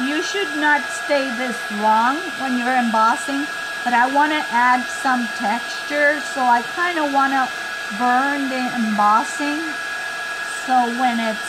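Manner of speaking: talking over a loud heat gun; machine noise behind. Real, not acted. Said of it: "Sorry for the noise of the machine".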